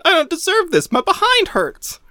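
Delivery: very high whimpering plea; whiny pleading. Acted. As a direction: plead like a spanked child. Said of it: "Rick pleaded".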